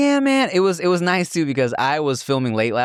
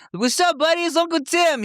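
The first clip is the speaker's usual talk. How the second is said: put-on much higher, sing-song voice for a toddler; high baby-talk greeting. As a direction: coo at the little boy.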